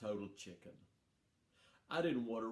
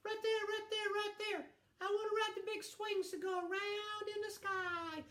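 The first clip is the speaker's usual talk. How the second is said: put-on much higher, squeaky excited little boy; little brother. Storyteller imitating little brother Joe, much higher and eager. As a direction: pipe up like an eager six-year-old. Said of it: "He would look at the first thing he saw and go".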